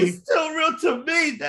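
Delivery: high, loud mock-sobbing yell; hammed-up tearful shout; fake crying shout. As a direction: wail it like a heartbroken fan. Echoing the crying fan meme.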